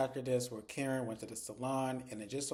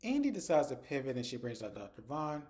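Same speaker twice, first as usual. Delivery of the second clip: normal talking voice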